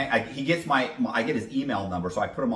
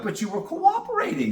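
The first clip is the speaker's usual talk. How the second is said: mock surprise; 10 semitones higher, 4 dB louder than usual. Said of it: "I have to act surprised".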